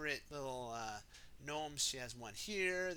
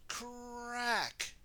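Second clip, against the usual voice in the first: crack sound; 8 semitones higher than usual. Acted. Paving stone breaks.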